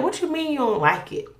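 high-pitched retold indignation; indignant challenge. Wife to husband about the baby's name, indignant. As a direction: challenge him, pitch jumping up.

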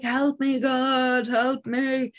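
desperate plea. Acted. Possessed priest begging God for help, desperate. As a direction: beg God to save you.

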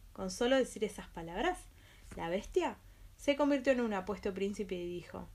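ordinary delivery, nothing marked

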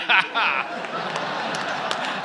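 breathy high laugh. Real, not acted. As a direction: laugh at your own joke. Laughs after his line.

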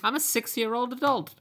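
confused little goblin, squeaking; lost bone goblin baffled by the portal; squeaky goblin. Retold or imitated. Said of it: "goblin voice continued".

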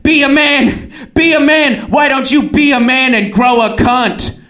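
high, insistent goading; mock yelled taunt. Woman goading him from outside, high mock yell. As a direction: imitate an enraged date hammering the insult.

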